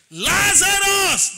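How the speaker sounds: high, loud, drawn-out call; resounding shout. Retold or imitated. Jesus calling Lazarus at the grave, shouted. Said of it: "he shouted".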